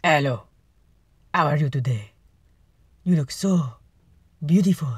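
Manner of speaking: mock-French flirt, like a cartoon; typical French accent. Greeting someone beautiful, in a hammed-up French accent. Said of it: "That's your typical French accent".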